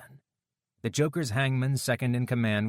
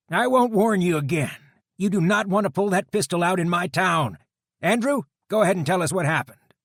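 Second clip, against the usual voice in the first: biker deputy voice; 10 semitones higher, 7 dB louder than usual. A narrator's reading. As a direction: coolly call out the guard's threat. Dutch voiced.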